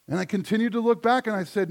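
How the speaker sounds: ordinary talk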